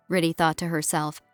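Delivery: usual speaking voice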